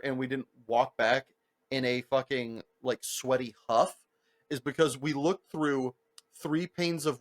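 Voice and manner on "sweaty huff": ordinary delivery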